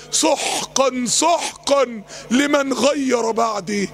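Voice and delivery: slow, drawn-out pronouncement; solemn quoted rejection. Retold or imitated. Preacher quoting the Prophet turning people away, slow and solemn. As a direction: stretch each سحقا out slowly.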